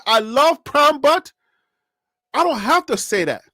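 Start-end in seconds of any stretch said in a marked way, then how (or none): 0.1-1.3 mocking quote. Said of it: "When you turn around and say".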